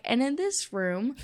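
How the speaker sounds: high singsong tour-guide patter; mock guide, pitched well up; chirpy tour guide. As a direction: present each death spot like a perky guide. Acts out tour guide showing death rooms.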